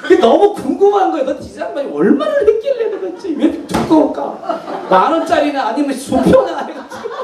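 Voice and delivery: 웃으며 높은 음으로 궁금해하기; 웃음을 참지 못하고 들떠서; laughing curiosity. Real, not acted. Curious about the thick envelope. (두꺼운 봉투가 궁금해 웃으며 말하는 목사)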